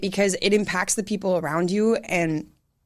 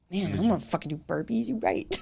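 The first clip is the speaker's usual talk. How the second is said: muttered gripe; 6 dB quieter than usual. Retold or imitated. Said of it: "all of a sudden you're like".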